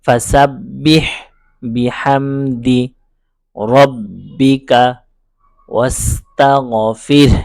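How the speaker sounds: each word chanted slowly and separately; slow, broken-up recitation, one word at a time. Shown as a demonstration. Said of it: "তারপর".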